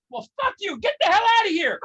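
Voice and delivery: loud, snapping comeback; hammed-up fed-up yell; mock angry retort. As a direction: tell her off with a shout on the end. Angry retort in a retold argument.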